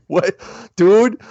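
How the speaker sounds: high-pitched, punchy disbelief; retold incredulous reply. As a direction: react in mock disbelief. Retelling own incredulous reply.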